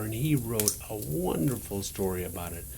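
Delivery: ordinary delivery, nothing marked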